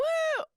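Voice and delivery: very high whooping laugh. Real, not acted. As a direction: let out a cheer of laughter.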